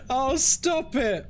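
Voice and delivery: high, drawn-out amused protest; helplessly amused, pitched high; laughing protest. Real, not acted. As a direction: plead through laughter, high and slow. Protesting while cracking up.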